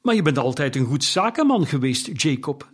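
ordinary delivery

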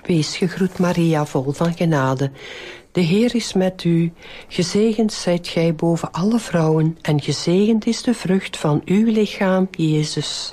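even, measured rosary lead; devout, rote Hail Mary cadence; prayer recitation. Real, not acted. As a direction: recite a well-known prayer in steady phrases.

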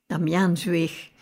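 ordinary delivery, nothing marked

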